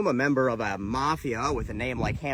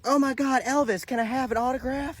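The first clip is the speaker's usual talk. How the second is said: much higher, gushing starstruck squeal; starstruck fan; 10 semitones higher than usual. Retold or imitated. Gate attendant recognizing [name], high starstruck gushing. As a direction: gush like a fan meeting her idol. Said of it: "And she's like".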